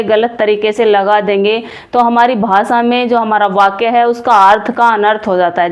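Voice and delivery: normal talking voice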